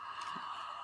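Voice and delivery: hushed breathy gasp of alarm; sharp gasp. Acted. Teacher reacting to the lightning, startled gasp.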